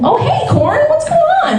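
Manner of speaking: very high, playful hello; mock-friendly greeting. Comedian pretends to greet corn seen in the toilet, high mock-friendly hello.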